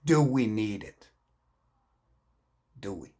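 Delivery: usual speaking voice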